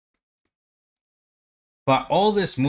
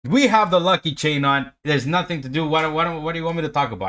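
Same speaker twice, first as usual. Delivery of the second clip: loud, higher, celebrating the win; hyped up; 3 semitones higher, 4 dB louder than usual. Real, not acted. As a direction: shout it out like the market just paid you. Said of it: "I gotta be hyped up".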